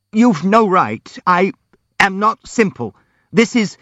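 high, halting protest; agitated, high-pitched and broken up; nervous babbling. A narrator's reading. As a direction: stammer out a flustered objection. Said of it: "babbled Brodowski nervously".

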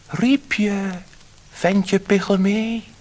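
high, loud little fish voice; a tiny magic fish answering from the sea; slow, high silvery fish voice. A narrator's reading. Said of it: "klonk uit zee een zilver stemmetje".

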